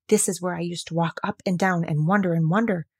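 usual speaking voice

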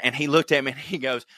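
usual speaking voice

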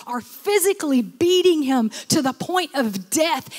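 ordinary delivery

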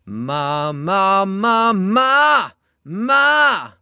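loud, high yelled ma; pressed, shouted singing. Shown as a demonstration. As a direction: yell the ma up to the D. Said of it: "I'd yell at them".

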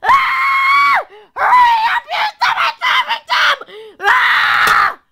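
very high screaming tantrum, played; mommy's road rage. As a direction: shriek like a mother losing it in traffic. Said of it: "Mommy's scary when she's in road rage".

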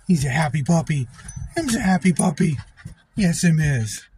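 high sing-song pet voice; talking to a dog. Real, not acted. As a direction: coo at your dog. Owner praising dog after grooming.

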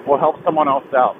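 ordinary talk

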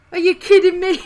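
loud, high disbelief; exasperated outburst. Real, not acted. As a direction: shout it at the screen in disbelief. Finds he could just walk through.

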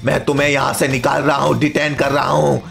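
deep, deliberate boss voice; menacing robot principal. Acted. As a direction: threaten like an evil robot headmaster.

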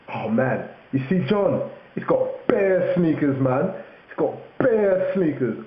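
high mock London lad, bear drawn out; London slang. Telling a mate about John's sneakers, mock London accent. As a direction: imitate an impressed Londoner, stretching bear. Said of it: "They say bear".

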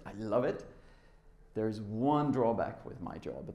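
ordinary talk

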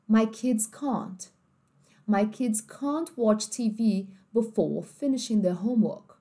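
slow British read, "can't" with a broad a; British accent. Shown as a demonstration. Teacher acting a British family, slow "can't". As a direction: say "can't" as kahnt, drawn out. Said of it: "uma família britânica viraria pra você e falaria assim".

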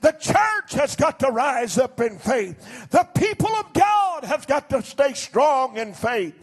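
ordinary delivery

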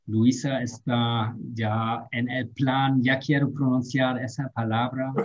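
ordinary talk

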